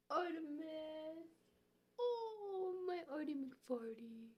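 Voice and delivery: high, loud cooing at a cat. Real, not acted. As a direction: call the cat sweetly, way up high. Calling and cooing at her cat.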